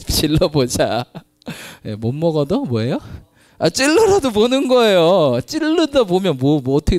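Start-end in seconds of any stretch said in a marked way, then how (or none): 3.6-7.0 fired-up preaching. Acted. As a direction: drive it home with fire. Preaching at full force.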